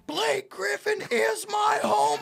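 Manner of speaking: put-on high drunken scream. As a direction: yell it at the top of your lungs. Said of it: "that whole night I just kept screaming".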